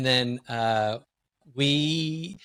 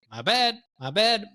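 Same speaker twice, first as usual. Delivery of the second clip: high-pitched playful "oops" apology; joking apology; 10 semitones higher, 3 dB louder than usual. Retold or imitated. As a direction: cut yourself off with a funny, high little apology. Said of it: "kinda made a funny out of it".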